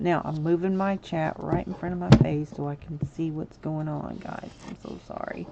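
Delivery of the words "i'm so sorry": breathy whispered apology; whispered I'm so sorry. Real, not acted. Embarrassed aside to the chat.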